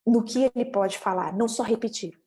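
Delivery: usual speaking voice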